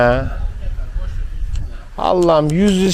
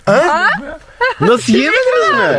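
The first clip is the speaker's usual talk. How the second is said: incredulous; 13 semitones higher than usual. Real, not acted. Incredulous laughing question.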